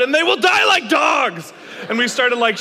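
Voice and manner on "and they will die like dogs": loud, high and slow, chanted in unison; hammed-up shout. The group answering Brad's rally, shouting together. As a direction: bellow it like a war chant. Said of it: "in unison and shouted".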